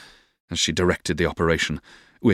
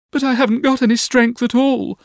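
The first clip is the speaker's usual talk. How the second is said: put-on very high girlish whine; Dora's protest; 4 dB louder than usual. A narrator's reading. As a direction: protest in a high little-girl voice. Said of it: "said Dora, shaking her curls".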